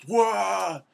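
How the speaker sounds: high, shouted "wah" of a creature flung away; sustained comic scream; loud cartoon wail. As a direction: yell as if sent flying. Buffalo yells as he is flung.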